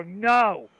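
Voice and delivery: loud, vehement acted denial; emphatic mock refusal. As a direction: burst out with a firm refusal. Acted emphatic refusal in retold sketch.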